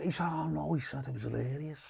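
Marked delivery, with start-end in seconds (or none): 0.3-0.7 much softer retold voice; quiet reassurance. Big man reassures the nervous comic, retold quietly. 1.1-1.8 very soft, lower retold reply; quiet reassurance. Big man lets the comic off, retold very quietly.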